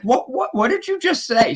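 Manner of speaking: high, incredulous what did you say; mock bafflement. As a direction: act confused by the long phrase. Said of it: "And I'm like".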